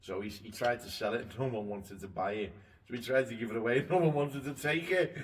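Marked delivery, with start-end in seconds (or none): 2.9-5.1 louder, rising amusement; laughing. Real, not acted. Telling how nobody would even take the free boat, laughing. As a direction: tell it through laughter, building higher and louder.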